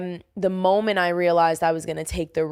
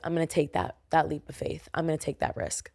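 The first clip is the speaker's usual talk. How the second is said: quiet resolve; 4 semitones lower, 7 dB quieter than usual. Retold or imitated. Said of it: "that was when I realized".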